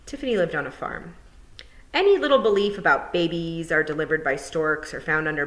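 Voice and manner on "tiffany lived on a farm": ordinary delivery, nothing marked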